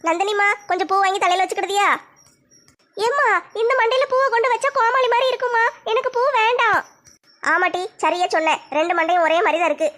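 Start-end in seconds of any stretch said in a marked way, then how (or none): 3.1-6.8 lightly voiced teenage daughter; a hint of a shy college girl talking to her mother; young daughter. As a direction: modest daughter, lightly played. Daughter refuses flowers.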